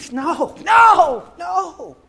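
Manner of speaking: high, loud no; refusing shout. Acted. An unbeliever shutting out the Lord, loud and high. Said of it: "we keep saying".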